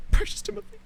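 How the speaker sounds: "Precious Timothy" as a tiny high squeak; squeaky Precious Timothy. Shown as a demonstration. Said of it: "repeat in different voice".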